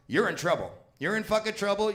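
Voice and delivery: ordinary talk, nothing marked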